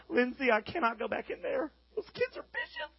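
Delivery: high, laughing whine of a defeated preacher; mock whining. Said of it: "wake up in the morning and go".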